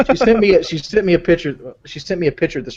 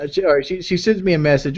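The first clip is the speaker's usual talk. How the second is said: normal talking voice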